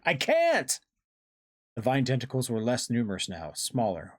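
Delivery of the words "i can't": very high, desperate cry; cried out. A narrator's reading. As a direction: peak in helpless desperation. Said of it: "I cried out".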